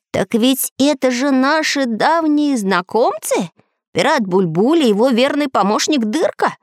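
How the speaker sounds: lightly voiced little robot man; iron man voice. A narrator's reading. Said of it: "воскликнул железный человечек".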